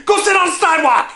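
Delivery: high, fast shouted insult. Retold or imitated. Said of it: "yell things at me".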